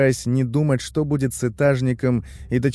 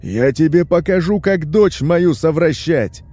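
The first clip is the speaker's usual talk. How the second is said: loud, raging father's threat; enraged major yelling through a door; furious bellowing; 6 semitones higher, 5 dB louder than usual. A narrator's reading. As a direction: roar it, dragging out the last words. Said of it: "орёт басом майор".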